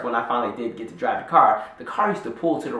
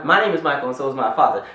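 ordinary talk

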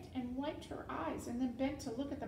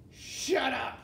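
loud, raised snap; angry silencing; 4 semitones higher, 8 dB louder than usual. A narrator's reading. Rat silencing the crying serving girl, loud and angry. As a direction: shut her up sharply.

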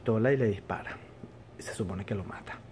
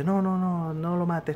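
higher, hurried no no no; urgent plea; 6 semitones higher, slower than usual. Retold or imitated. Police chief begs Gordon not to shoot, urgent higher plea. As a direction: stop him before he pulls the trigger.

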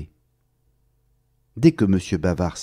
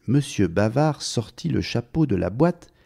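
plain reading voice

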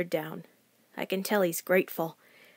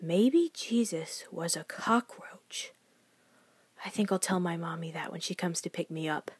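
whispered; 3 semitones lower, 7 dB quieter than usual. A narrator's reading. Child narrator whispering a secret thought. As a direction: confide it under the breath.

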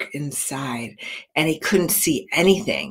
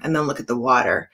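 ordinary delivery, nothing marked

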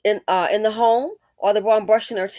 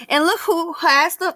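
usual speaking voice

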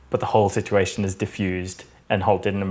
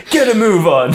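mock command; 10 semitones higher, 7 dB louder than usual. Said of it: "turns on his lip".

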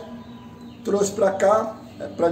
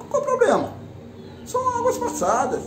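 exasperated outburst. Real, not acted. Rant defending coach, voice shoots up.

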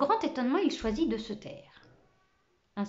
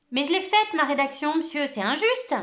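put-on much higher and louder, a boy crying injustice; indignant protest; 7 semitones higher, 7 dB louder than usual. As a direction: protest it hotly, voice jumping up high. Boy protests unfair detention.

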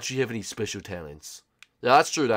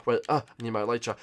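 ordinary delivery

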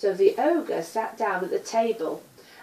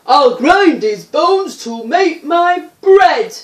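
loud, high booming giant's chant, slowing at the end; ogre roaring; 6 semitones higher, 16 dB louder than usual. A narrator's reading. Ogre roaring after Jack as he flees, loud and high. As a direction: bellow it like a hungry giant on the hunt. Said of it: "the ogre".

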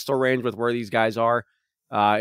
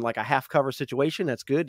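usual speaking voice

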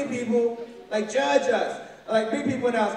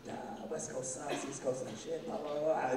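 mock dismissive; 2 semitones lower, 11 dB quieter than usual. New Yorkers trashing LA, soft mocking dismissal. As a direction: brush off the West Coast lazily.

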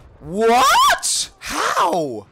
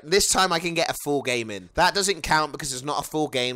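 ordinary delivery

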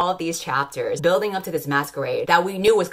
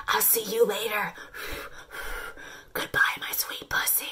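softer, then a breathy whispered goodbye; mock whisper; 5 semitones higher, 7 dB quieter than usual. Vlogger mocking his goodbye, whispered farewell. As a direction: whisper it tenderly as a joke. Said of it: "He's like".

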